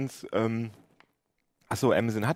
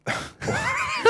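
burst of laughter. Real, not acted. Failed Alexa question.

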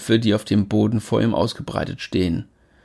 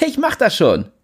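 cocky voice; 9 semitones higher than usual. Acted. Jimmy cocky.

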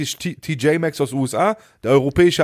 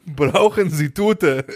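slow, high, laughing through the cashier's broken German; laughing imitation; 5 semitones higher, much slower than usual. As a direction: repeat his question while cracking up. (lachend und langsam nachgeäfft, höher)

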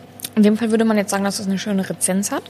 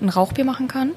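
ordinary talk

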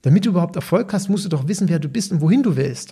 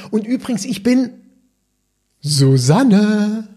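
client's reveal; 5 semitones higher, 3 dB louder, slower than usual. Retold or imitated. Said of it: "da ruft eine interessierte Kundin an".

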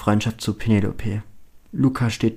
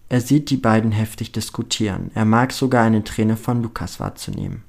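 plain reading voice

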